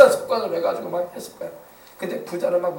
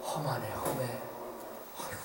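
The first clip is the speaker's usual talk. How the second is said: pitying mourner; 3 semitones lower, 12 dB quieter than usual. Retold or imitated. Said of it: "이런 소리 들으면".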